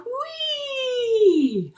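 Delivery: high squealing whee with a laugh; gleeful whee. Acted. Spinning penguin squeals with delight. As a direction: let out a dizzy joyful whee.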